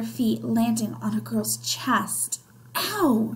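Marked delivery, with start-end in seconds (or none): 2.7-3.3 high, brief yelp of pain; mild cry. A narrator's reading. Girl stepped on in the train car, mild high cry. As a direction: yelp lightly, as a narrator voicing pain. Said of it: "she cried".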